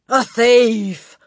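loud, high scream. Acted. Headmistress screaming at the boy. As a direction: scream the accusation. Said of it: "the Trunchbull screamed".

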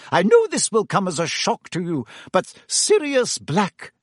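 lightly voiced strict teacher, slowing on the name; a hint of a grave headmistress; stern professor. A narrator's reading.

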